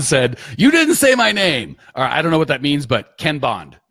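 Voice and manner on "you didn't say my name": high, loud, slow mock-offended read; mock hurt viewer. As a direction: play up the viewer's wounded complaint. Reading a viewer's complaint aloud.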